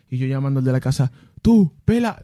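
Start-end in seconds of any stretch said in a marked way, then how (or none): 1.4-2.2 mock urgent call, louder and higher. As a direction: shout for the owner in a panic. Calling out to the owner. (llamada urgente, más alta y aguda)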